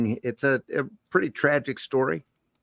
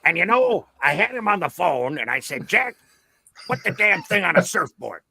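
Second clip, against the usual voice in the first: high, brash storyteller voice; Stan Lee; 6 semitones higher than usual. Retold or imitated. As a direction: brag about calling Jack. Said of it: "imitate Stan Lee".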